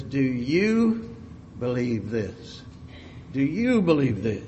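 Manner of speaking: YOU lifted above the other words; stress on YOU. Shown as a demonstration. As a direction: question pointed at the listener through "you". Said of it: "emphasis can be on the word".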